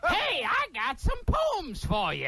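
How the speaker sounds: high, slow rhyming showman; Nipsey Russell impression. Comic doing Nipsey Russell for the host, high and slow. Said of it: "It's Nipsey Russell".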